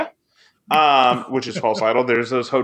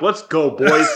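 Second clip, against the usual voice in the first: frat bro hype. Real, not acted. Hyping the crew.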